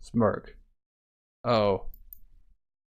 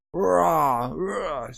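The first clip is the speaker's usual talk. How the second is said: high, strained charging yell; battle cry; 5 semitones higher than usual. Acted. Warrior charging alone at soldiers, battle cry. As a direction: charge into the enemy ranks.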